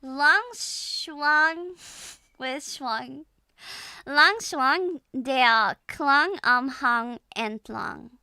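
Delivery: deliberate German, word by word; German tongue twister. Shown as a demonstration. Trying a short German tongue twister, careful loud German. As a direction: attempt the short German twister, loudly and slowly. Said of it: "Maybe I can do this short one".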